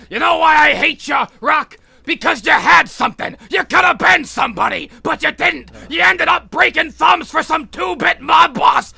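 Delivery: angry trainer. Retold or imitated. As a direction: yell it as the disappointed old trainer. Trainer berating fighter in retold scene.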